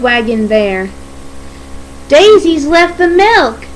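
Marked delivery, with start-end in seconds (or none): 2.1-3.6 high, cross complaint. Acted. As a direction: complain crossly, pitch raised. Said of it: "Percy said crossly".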